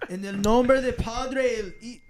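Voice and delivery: priest from The Exorcist. Acted. Reading a donor's super chat, mock priestly proclamation. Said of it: "sound like the priest from The Exorcist".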